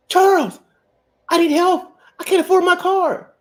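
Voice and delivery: a lightly hammed-up woman asking for money; mock woman pleading. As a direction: pitch up and plead like a broke girlfriend. Mimicking a woman asking for help.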